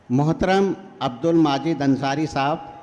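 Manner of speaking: ordinary talk, nothing marked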